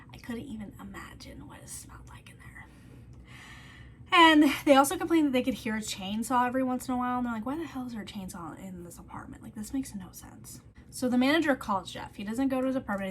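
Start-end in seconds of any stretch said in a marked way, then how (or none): 0.1-2.7 soft whisper of disbelief; whispered disgust. Real, not acted. Disgusted aside about the smell. 7.5-10.6 neighbours' complaint in a whisper; whispered quote. Retold or imitated. Neighbours complaining about chainsaw.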